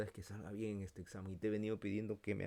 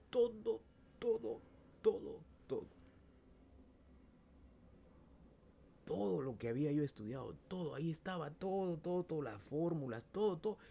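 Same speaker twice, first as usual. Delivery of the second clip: amazed exclaiming; 8 semitones higher than usual. Real, not acted. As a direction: marvel at every word. Asombro al ver el examen. (asombro exclamado)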